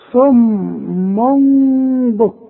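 صم بكم very slowly, long hummed "m" before the ba; "summum-bukmun" with a stretched, hidden meem; tanween turned meem. Shown as a demonstration. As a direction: chant صم بكم, holding the nasal hum between the words. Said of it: "اقلب التنوين ده إلى ميم".

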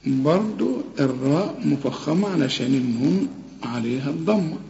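normal talking voice

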